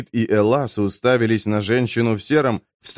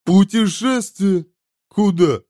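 high, stretched-out question; startled glutton; 9 semitones higher, slower than usual. A narrator's reading. Glutton hears of a journey, high startled question. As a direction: exclaim it in disbelief.

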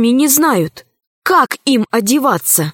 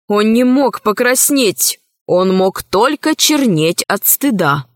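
ordinary delivery

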